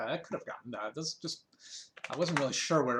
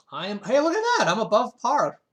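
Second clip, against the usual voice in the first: much higher, louder thrilled exclaim; delighted surprise; 9 semitones higher, 12 dB louder than usual. Real, not acted. Player spots his score is above par, thrilled. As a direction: catch the good news with a yelp.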